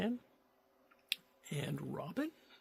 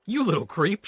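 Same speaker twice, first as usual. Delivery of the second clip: loud, higher angry yell; shouted; 8 semitones higher, 10 dB louder than usual. Acted. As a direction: bark it at the kid. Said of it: "shouted the thief".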